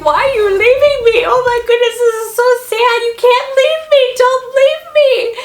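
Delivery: high, loud whimpering dog plea; dog at the window begging owners to stay, high and loud; sad pleading dog voice. Retold or imitated. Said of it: "He's like".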